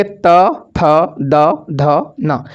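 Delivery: त, थ, द, ध, न each spoken alone, tongue at the teeth; teacher sounds out त, थ, द, ध, न one by one; slow recital of the letters. Shown as a demonstration. Teacher shows students how to say त, थ, द, ध, न, sounding each out. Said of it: "जैसे आप बोलेंगे".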